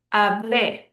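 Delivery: hablé with weight on final -BLÉ; stress on last syllable. Shown as a demonstration. Teacher repeating preterite, hablé stressed on the last syllable. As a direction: lean on the end, ha-BLÉ. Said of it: "That's the last syllable is stressed".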